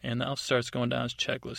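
ordinary delivery, nothing marked

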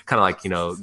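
usual speaking voice

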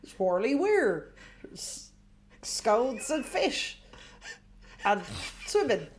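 slow, lilting West Country tourist ad; West Country accent. Pretend. Asked to advertise the weir, a slow West Country tourism ad. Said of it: "can you give us a little West Country Tourist Board announcement".

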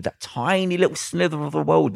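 usual speaking voice

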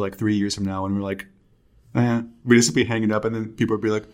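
ordinary delivery

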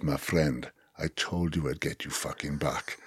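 low, quiet threat in a mock South African accent. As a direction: imitate a South African, soft and low with menace. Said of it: "not really good at doing a South African accent".

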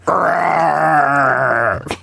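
drawn-out lion growl; playful monster growl. Acted. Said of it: "personifying animals".

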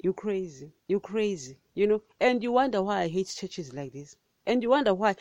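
normal talking voice

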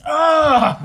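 sharp high yelp of pain; loud pained yelp. Retold or imitated. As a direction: yelp like you just banged your head. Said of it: "And I loudly went".

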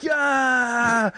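high, drawn-out victory cry; mock triumphant cheer. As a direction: cheer like you finally won, hammed up. Retold triumph after finally winning.

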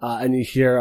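ordinary delivery

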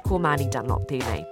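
ordinary delivery

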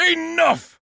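sudden high shout of Enough. Acted. Narrator shouting to stop the people demanding his stuff. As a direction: cut them off with a loud, high cry.

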